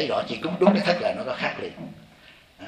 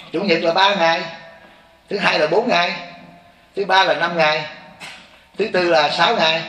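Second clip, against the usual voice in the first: higher and louder, each day hammered with pauses; emphatic counting; 6 semitones higher, 5 dB louder than usual. Acted. Monk counting the forty-nine days for listeners, raised emphatic voice.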